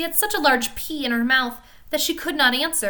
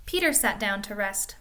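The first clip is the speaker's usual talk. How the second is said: plain reading voice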